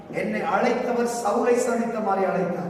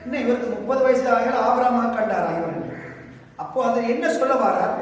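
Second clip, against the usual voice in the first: ordinary talk, nothing marked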